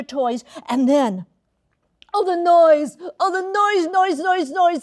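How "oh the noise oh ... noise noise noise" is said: higher, louder cry of annoyance; fed-up outburst over the racket; exasperated. A narrator's reading. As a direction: throw your hands up at the din. Narrator mimics hated noise.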